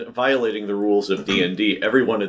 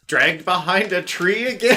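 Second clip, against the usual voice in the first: mock exasperated viewer; 11 semitones higher than usual. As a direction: drag it out like a tired fan. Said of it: "for each are things like".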